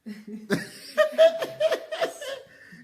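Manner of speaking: high-pitched bubbling laugh; helpless high laughter; real laugh. Real, not acted. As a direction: let out a long, high giggle. Laughs after admitting discomfort.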